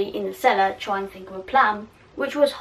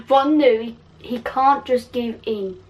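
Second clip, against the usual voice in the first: ordinary delivery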